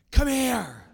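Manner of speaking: high, loud call meant as a whisper; comically too-loud summons; booming failed whisper. Shown as a demonstration. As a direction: try to whisper but belt it out, for laughs. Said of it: "I mean, I'm whispering".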